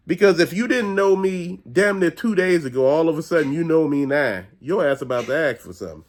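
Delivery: normal talking voice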